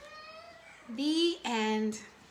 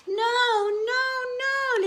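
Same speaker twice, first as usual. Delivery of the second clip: high, louder sing-song no, no, no; scolding sister; 13 semitones higher, 6 dB louder than usual. Acted. Sister warns Little Hippo about jumping, high sing-song scolding. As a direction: warn him in a sing-song tease. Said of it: "Little Hippo said".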